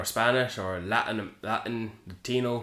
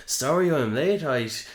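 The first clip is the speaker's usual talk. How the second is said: mocking quote; 6 semitones higher, 4 dB louder than usual. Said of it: "the first line he says".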